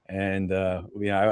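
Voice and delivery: ordinary talk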